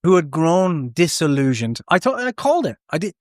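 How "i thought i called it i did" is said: high, triumphant I called it; excited gloating. Real, not acted. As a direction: crow about being right. Gloating about prediction.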